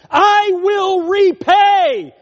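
high, loud, very slow proclamation; thundering. Acted. Preacher voicing God promising vengeance, thundering. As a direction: stretch it out like divine wrath.

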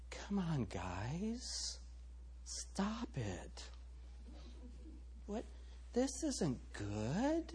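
hushed, wimpy mock pleading; barely-there whispery protest, mocking a timid Jesus; meek, timid Jesus. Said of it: "How many of you think that Jesus walked into that temple and said".

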